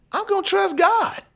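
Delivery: high-pitched, insistent self-defence; fervent retort. Retold or imitated. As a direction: answer the doubters with soaring conviction. Retelling defiant answer to doubters.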